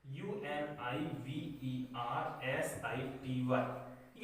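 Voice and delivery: slow spelling of यूएनआईवीईआरएसआईटीवाई, each letter named; spelling a word aloud for students; spelled out letter by letter. Shown as a demonstration. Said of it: "ये लिख दिया मैंने".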